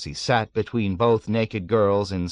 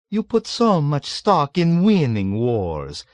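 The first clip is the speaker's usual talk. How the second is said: put-on slow, raised, scornful old man; scoffing; 7 semitones higher, 5 dB louder, slower than usual. A narrator's reading. Old man mocks Nately's belief, scoffing. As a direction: scoff at the young man's faith. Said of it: "the old man scoffed".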